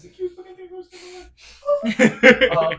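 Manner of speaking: bursting into loud, high laughter mid-sentence; real delighted laugh through the words; laughing loudly. Real, not acted. GM delighted by the rabbit.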